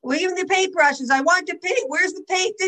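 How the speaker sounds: grandma mimics her impatient grandchildren asking for paint, high and whiny; imitating the grandkids. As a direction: mimic little kids nagging for paint. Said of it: "they're at that age where they ask".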